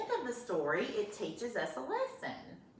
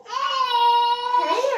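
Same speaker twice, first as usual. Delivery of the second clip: cooing aw; 14 dB louder than usual. Real, not acted. Granddaughter fussing on lap.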